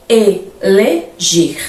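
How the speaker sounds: Reeleger stretched very slowly, re-e-le-ger; slow syllable demo. Shown as a demonstration. Teacher stretching Reeleger so students hear the hiatus. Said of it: "Analisem o vocábulo".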